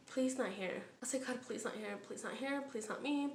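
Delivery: soft, hushed begging; retold pleading prayer. Inmate praying the screamer goes elsewhere, hushed retold pleading. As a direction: pray under your breath, imitating your own dread.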